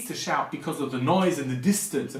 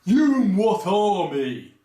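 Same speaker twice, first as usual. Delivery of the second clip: mocking movie line; 4 dB louder, slower than usual.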